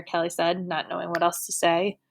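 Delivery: ordinary delivery, nothing marked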